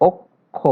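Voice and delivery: "অক্ষ" broken as ok... kho; split k then kh. Shown as a demonstration. Teacher shows how the cluster sounds, "অক্ষ" as ok-kho. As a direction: say "অক্ষ" in two parts, k before aspirated kh. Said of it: "প্রথমে আমরা ক পাবো, পরে খ".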